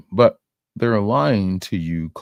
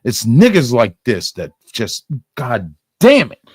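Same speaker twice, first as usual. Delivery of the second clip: rising angry rant, louder; angry outburst; 3 semitones higher, 6 dB louder than usual. Real, not acted. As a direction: swear it out, fed up. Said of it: "I can tell you're angry right now".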